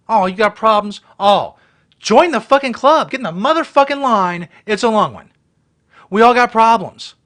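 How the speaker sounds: loud, furious rant at her, pitch up; angry rant. Real, not acted. Vlogger rants at the Walmart woman, furious and loud. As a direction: bark it out like you've had enough.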